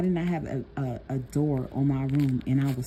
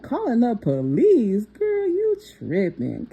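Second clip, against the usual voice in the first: mocking disbelief; 8 semitones higher, 4 dB louder, slower than usual. Mocking mom's threat.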